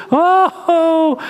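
a high, crying "Oh"; mock sobbing wail. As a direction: wail it like a weeping wife. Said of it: "she's just crying".

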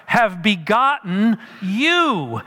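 slow phrase, "you" drawn out with a falling tune; stress on YOU. Acted. Paul addressing his spiritual children, emphatic stress on "you". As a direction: stretch out "you" at the end for emphasis. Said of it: "and he emphasizes the word you".

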